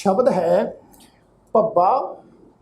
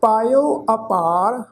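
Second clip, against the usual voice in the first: slow ਪਾਇਓ ਅਪਾਰ, resting after ਅਪਾਰ; pause after phrase; much slower than usual. Shown as a demonstration. Teacher shows where to rest, pause after ਅਪਾਰ. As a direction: draw out ਅਪਾਰ, then stop. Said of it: "ਅਪਾਰ ਸ਼ਬਦ ਤੋਂ ਬਾਅਦ ਵਿਸ਼ਰਾਮ ਚਿੰਨ੍ਹ".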